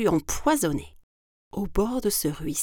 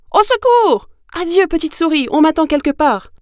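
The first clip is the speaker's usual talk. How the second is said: high, loud cry for help; terrified fox shrieking a farewell; panicked shout; 11 semitones higher, 12 dB louder than usual. Acted. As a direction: scream it while running away. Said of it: "il cria".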